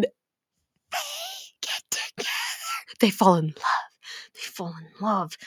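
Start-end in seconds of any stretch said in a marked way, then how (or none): 0.0-2.3 soft breathy whisper; swooning whisper. Real, not acted. About the couple, a swooning whisper. 4.3-5.4 dreamy hush. Real, not acted. Repeating dreamily softer.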